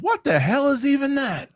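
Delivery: very high-pitched mock outrage; high, incredulous clip impression; old man imitation. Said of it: "And he goes".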